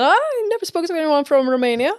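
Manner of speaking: high-pitched imitation of a sure-of-himself man; retold confident claim. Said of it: "He was very confident about that".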